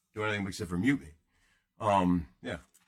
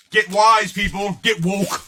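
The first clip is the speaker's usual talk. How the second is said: mock rallying cry; 6 semitones higher, 9 dB louder, slower than usual.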